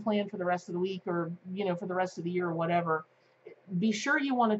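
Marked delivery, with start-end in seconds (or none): none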